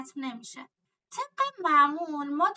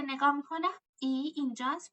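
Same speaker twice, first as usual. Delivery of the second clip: ordinary talk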